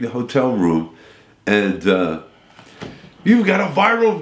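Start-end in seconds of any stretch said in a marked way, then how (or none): 3.2-4.2 high, excited call-in voice; excited radio hosts. Retold or imitated. Radio hosts telling Ted he went viral, excited. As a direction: blurt out the big news. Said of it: "this is Dave and Jimmy".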